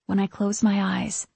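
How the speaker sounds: plain reading voice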